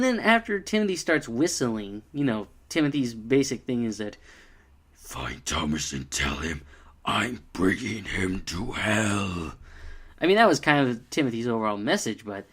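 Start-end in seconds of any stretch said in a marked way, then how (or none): 4.9-9.5 much lower, slow and ominous; menacing ghost voice. Retold or imitated. Reviewer voicing Timothy's sinister message, low and ominous. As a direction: deliver it like a dark threat from beyond.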